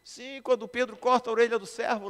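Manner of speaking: high, very loud question; full-force pulpit challenge; preacher calling out. Real, not acted. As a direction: shout it to the congregation. Calling out to congregation.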